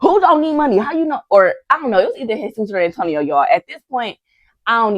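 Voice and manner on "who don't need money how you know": higher-pitched mock outrage; indignant twin. A YouTuber voicing an angry twin contestant, mock outraged. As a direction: play the offended twin, pitched up. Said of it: "Jesus was just like".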